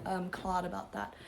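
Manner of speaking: ordinary delivery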